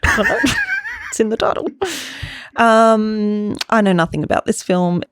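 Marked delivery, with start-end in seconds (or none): 0.0-1.7 high giggly voice; laughing. Real, not acted. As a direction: giggle through the words.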